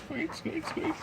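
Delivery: very high, soft squeak noise; squeaky shoes. Retold or imitated. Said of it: "the sound of squeaky tennis shoes".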